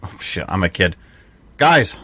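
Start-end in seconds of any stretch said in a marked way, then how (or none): 1.6-2.0 short high, loud call-out; acted yell. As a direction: holler for your friends to come. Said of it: "And I just yell out".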